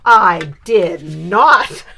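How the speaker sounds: put-on loud, slow, outraged child's denial; indignant denial. Child denying to grandma, loud and slow. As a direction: drag it out in shock: I did not.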